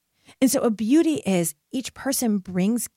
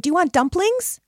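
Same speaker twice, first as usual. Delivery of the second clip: higher, bright offering voice; inviting stranger; 9 semitones higher, 4 dB louder than usual. Retold or imitated. Woman at night offering dumplings to passing friends, high and inviting. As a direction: call out an offer to passersby. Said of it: "and a woman said".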